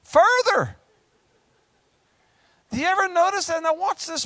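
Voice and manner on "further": mock disbelief. Jeremiah sent further down.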